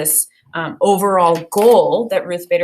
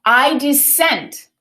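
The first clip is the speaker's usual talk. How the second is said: slow, firm declaration of dissent; solemn dissent; 5 semitones higher, much slower than usual. Acted. Teacher voicing Justice Ginsburg dissenting from the court, slow and grave. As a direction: announce it slowly and deliberately like a judge on the bench.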